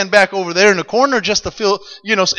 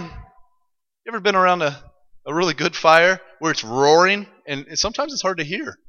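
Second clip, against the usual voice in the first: ordinary delivery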